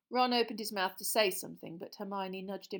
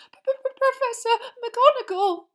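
stammered, high, loud and slow; gasping in fright; 14 dB louder, slower than usual. Acted. Girl gasping out of the boggart trunk, terrified. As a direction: stutter it out in terror. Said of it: "Hermione gasped".

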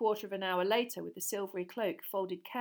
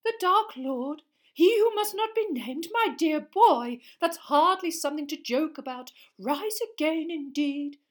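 startled professor; 8 semitones higher, 11 dB louder than usual. A narrator's reading. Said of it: "looked thoroughly startled".

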